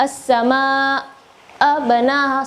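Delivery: as-sama-a banaha said slowly and joined; as-sama-a banaha drawn out as one flow; over enunciating. Shown as a demonstration. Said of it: "seharusnya as-sama-a".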